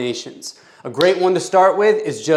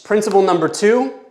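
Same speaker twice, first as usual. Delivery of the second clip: ordinary delivery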